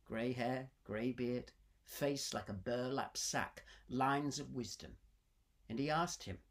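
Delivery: plain reading voice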